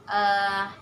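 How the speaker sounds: ordinary talk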